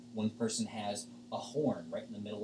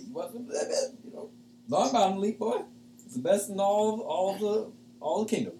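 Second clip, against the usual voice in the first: stoner offering a joint; 10 semitones higher, 6 dB louder than usual. Acted. Shaggy guest offering Claudio his joint, high loud stoner voice. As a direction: push the weed on him like a buddy.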